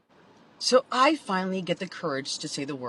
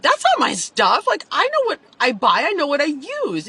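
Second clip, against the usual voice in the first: louder and higher, insisting; heated retort; 6 semitones higher, 7 dB louder than usual. Retold or imitated. Telling her boyfriend the stuff isn't hers, indignant. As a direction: push back hard, indignant. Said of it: "I was like".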